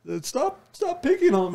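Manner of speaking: high, whiny wimp voice; wimpy voice. Acted. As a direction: whine it like a scared wimp. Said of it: "make it sound like a freaking wimp".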